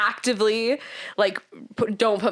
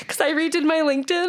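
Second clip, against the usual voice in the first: crying out the reason, higher and breaking; reliving a sob, voice high and teary; retold sobbing; 8 semitones higher, faster than usual. Telling her roommate why she cried, retold sob. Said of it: "And I was, like, sobbing".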